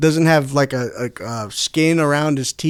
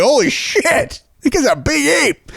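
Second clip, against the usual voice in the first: higher, slowed gasp of wonder; mock astonishment; 7 semitones higher than usual. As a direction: gawk at the ape like a tourist. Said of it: "we're gonna be like".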